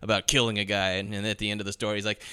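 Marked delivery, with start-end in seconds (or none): none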